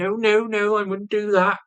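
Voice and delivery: high-pitched mock denial; imitating his partner. Voicing her reply to him.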